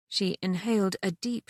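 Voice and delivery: plain reading voice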